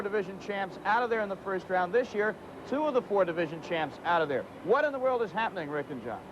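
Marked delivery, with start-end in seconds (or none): none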